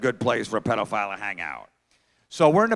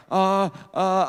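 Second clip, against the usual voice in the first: high, drawn-out hesitation; panicking kid; 5 semitones higher than usual. Retold or imitated. Six-year-old facing bright stage lights, mock panic. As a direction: imitate a child frozen at the mic. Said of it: "he's panicking".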